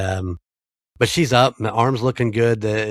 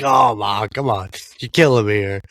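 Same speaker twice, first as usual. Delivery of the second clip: louder, mock-weary groan at mom; exasperated plea; 4 dB louder than usual. Son pleading with his mom after the scare, exasperated. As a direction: playfully beg her to stop.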